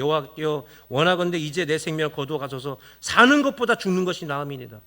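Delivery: low, steady verse reading; scripture reading. Acted. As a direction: read Jonah's plea from the text. Reads the verse. (낮고 차분한 성경 봉독)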